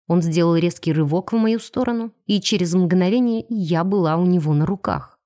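ordinary delivery, nothing marked

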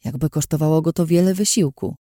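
ordinary delivery, nothing marked

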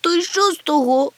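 high, loud tearful retort; whimpering. A narrator's reading. Chmykh refuses to be comforted, whimpering. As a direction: whimper it back, high and whiny. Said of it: "хлипнув він".

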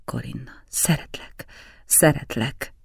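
breathy hushed declaration of love; whispered love. A narrator's reading. Lover whispering to Corinna, hushed confession. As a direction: whisper it close to her ear.

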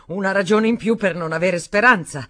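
loud, bitter angry reply. Acted. Daniel answering Stephanie as angry as she is. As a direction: hit back just as angry. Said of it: "arrabbiato quanto lei".

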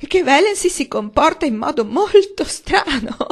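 high, loud quavering cry; tearful wailing. A narrator's reading. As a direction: weep while confessing to the family. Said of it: "apertamente in lacrime". (madre in lacrime che si lamenta)

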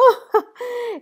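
high, loud delighted laugh; burst of laughter. A narrator's reading. The duchess laughing at Herr Dremmel's question. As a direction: let out a sudden amused laugh. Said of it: "esclamò la duchessa".

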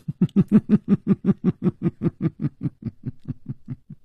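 long high giggle fading down; dreamy giggle. A narrator's reading. 宫南燕对熊娘子痴痴地笑，a long high giggle. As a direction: giggle foolishly, drifting off. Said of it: "痴痴地笑着".

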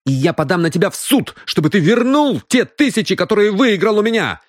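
high-pitched, heated threat; indignant threat. Acted. Сандал threatens to sue for his money, indignant.

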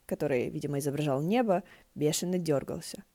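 ordinary delivery

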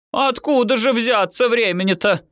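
very high, indignant sarcasm; exasperated. A narrator's reading. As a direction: throw up your hands and wail it. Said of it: "взмахнула руками мама".

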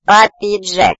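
high, loud squeaky cry; little creature exclaiming. A narrator's reading. Little one calls out to his papa Jack, high exclaiming. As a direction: pipe it up like an excited tiny creature. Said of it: "воскликнул малыш".

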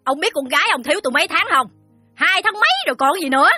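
very high, fast, tart scolding; shrewish. Acted. Moneylender reminding the father of overdue months, sharp-tongued. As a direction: nag sourly at a fast clip. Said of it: "Mụ ta nói chanh chua".

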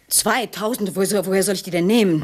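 high, loud dismay; shocked outrage. Acted. Woman balks at two thousand francs, shocked. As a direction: balk at the price.

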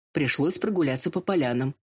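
plain reading voice

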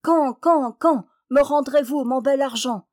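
réclamer haut et fort, plus aigu; réclamation criée. A narrator's reading. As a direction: lancer son refrain comme une sommation. Réclame son argent au roi. (le canard réclame son argent au roi, criant fort)

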